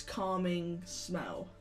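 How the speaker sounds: normal talking voice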